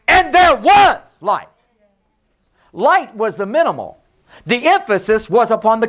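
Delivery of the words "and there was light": preaching at full force. Acted. Preacher to congregation, slow booming. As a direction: ring it out to the church.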